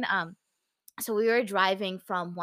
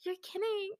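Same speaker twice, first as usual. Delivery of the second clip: high, softer stunned gasp of words; retold disbelief; 9 semitones higher, 7 dB quieter than usual. Fan retelling her reaction to the town, stunned disbelief. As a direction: act out hushed, high-pitched disbelief.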